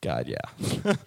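very low, drawn-out yes to God; answering God's offer in a very low voice; deep surrender. Retold or imitated. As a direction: sink the pitch way down in relief. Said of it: "I was just like".

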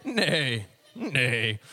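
drawn-out mock neigh, sliding down; horse neigh. Horse laughing at the rider it threw, mocking neigh. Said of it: "laugh at you and go".

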